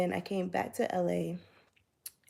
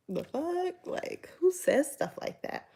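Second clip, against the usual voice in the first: high-pitched, incredulous reaction; slow, high mock bewilderment; retold disbelief; 8 semitones higher, slower than usual. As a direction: act out baffled outrage, pitched way up. Retold baffled reaction to his remark.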